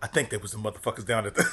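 low, soft, quick acted line; quiet character. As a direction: say it under your voice, in character. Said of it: "my character's very quiet".

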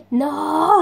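an acted shout of disbelief; mock horrified no. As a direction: play the horror of a dead battery, over the top. Acting her dead-battery reaction.

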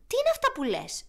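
put-on old frog shouting at the hedgehog; old frog calling out. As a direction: shout it across the pond. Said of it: "του φώναξε ένας γεροβάτραχος".

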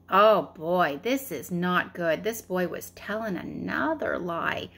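plain reading voice

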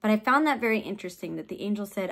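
ordinary talk

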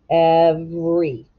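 Every said slowly and clearly; stretched word. Shown as a demonstration. Teacher modelling the word slowly. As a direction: draw out Every for the class. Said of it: "with a macron".

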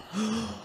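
a sharp, high gasp of fright; a player startled by something in the dark, gasping. Real, not acted. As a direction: suck in air at a sudden scare. Another jump scare.

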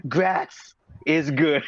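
mild mock shout, drawn out; imitated shout. Recalling Rick's silly catchphrase for friends, imitated shout. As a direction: slowly call out the catchphrase in imitation. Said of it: "him shouting".

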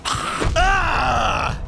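sharp high yelp of fright; sudden shouted ah; startled yelp. Acted. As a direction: jump out of your skin with a yelp. Startled by exploding bug.

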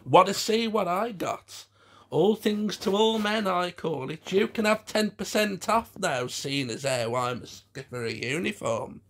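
high, brazen puppet flirt; Hedwig.

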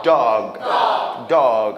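"dog" drilled loud and low as daw-g; open-mouthed vowel. Shown as a demonstration. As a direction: drop the jaw on "dog". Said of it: "입을 벌려서".

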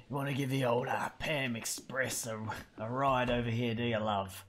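Pam voice. Acted. Voicing Pam offering ride.

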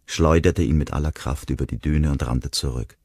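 ordinary delivery, nothing marked

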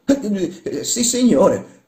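high, loud, drawn-out yes; startled admission. Acted. Workman confessing to his shouting master, startled. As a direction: blurt the confession, stretched out. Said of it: "confessò Spatolino".

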